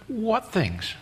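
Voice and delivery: soft, feigned ignorance; playing innocent. Jesus drawing the travelers out, playing the innocent. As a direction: ask it quietly, as if clueless. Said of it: "playing the innocent".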